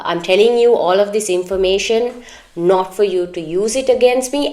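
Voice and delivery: ordinary talk, nothing marked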